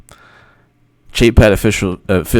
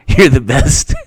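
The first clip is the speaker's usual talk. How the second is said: ordinary delivery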